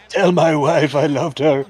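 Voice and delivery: slow, strained dying last words; wounded soldier gasping. Pretend. Dying wrestler voiced by commentator.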